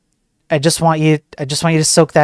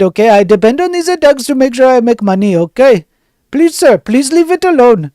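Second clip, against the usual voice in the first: much higher, pleading whiny voice; pleading hacker; 7 semitones higher, 4 dB louder than usual. Pretend. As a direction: beg the French officer in a high whine. Hacker begging French officer.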